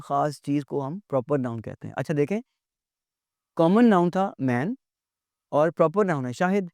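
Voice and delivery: ordinary delivery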